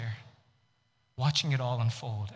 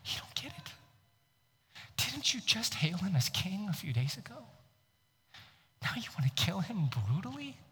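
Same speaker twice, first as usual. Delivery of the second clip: soft, hushed incredulous question; hushed disbelief; 4 semitones higher, 8 dB quieter than usual. Acted. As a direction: breathe it out in disbelief. Bewildered soldier questioning the crowd.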